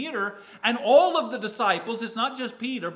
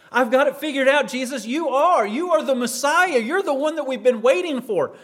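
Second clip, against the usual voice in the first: higher, louder, eager declaration; excited confession; 4 semitones higher, 6 dB louder than usual. Acted. A disciple telling Jesus who he is, excited. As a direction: announce it proudly, raised in pitch and volume.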